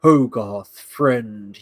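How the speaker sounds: low, halting giant voice; one word at a time, deep and broken; Iron Giant. Retold or imitated. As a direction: imitate a lumbering robot learning English. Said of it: "imagine the Iron Giant going like".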